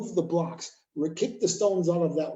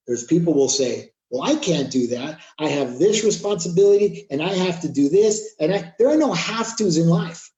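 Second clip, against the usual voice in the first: forceful preaching; 4 semitones higher, 7 dB louder than usual. Acted. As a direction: preach it hard at the crowd, louder.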